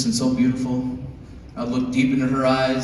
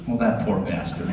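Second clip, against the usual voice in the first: pitying mutter; 5 semitones lower, 7 dB quieter than usual. Retold or imitated. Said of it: "be like".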